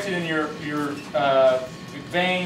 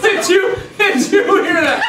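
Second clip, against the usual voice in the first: trembling whiny yelps; 11 semitones higher, 7 dB louder than usual. Acted. Mock tough guy startles listener.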